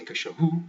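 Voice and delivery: CACHORRO with the final RRO stressed; wrong-syllable stress. Shown as a demonstration. Teacher showing what you don't say, last-syllable stress. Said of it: "You don't say".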